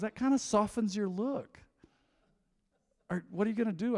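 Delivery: high-pitched imitation of a mom; mom's voice. Mom softens her verdict on bald son, high mom voice. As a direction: lift it high, trying to be kind.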